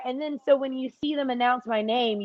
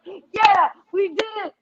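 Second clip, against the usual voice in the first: much higher, louder mock cheer; imitated cheer; 10 semitones higher, 5 dB louder than usual. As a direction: replay the giddy victory cheer for fans. Posing with fans after winning.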